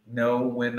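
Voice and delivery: ordinary talk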